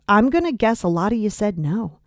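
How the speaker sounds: ordinary talk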